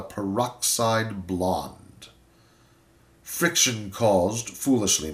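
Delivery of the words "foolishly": ordinary delivery, nothing marked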